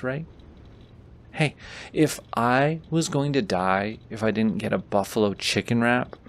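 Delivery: ordinary talk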